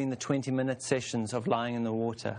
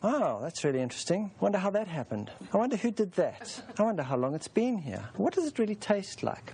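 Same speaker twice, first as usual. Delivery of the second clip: higher, wide-eyed fascinated musing with a little laugh; mock curiosity; 6 semitones higher than usual. Teacher mocking the ego poking at old bathwater, higher, amused fake fascination. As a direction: pretend to be idly intrigued by the slime. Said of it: "It's no point in going".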